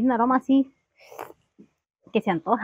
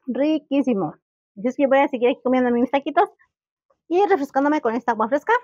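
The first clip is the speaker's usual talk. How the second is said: mouth full. Real, not acted. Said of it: "vamos a agarrar a mordidas este taco".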